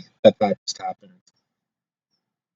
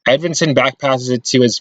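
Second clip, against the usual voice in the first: normal talking voice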